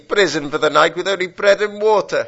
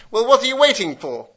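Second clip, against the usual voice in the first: ordinary delivery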